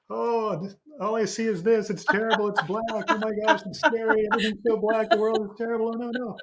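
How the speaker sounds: high-pitched, hammed-up overwhelmed voice; acted panic. Speaker acts out being stuck on one scary picture, mock panic. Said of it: "instead of being like this".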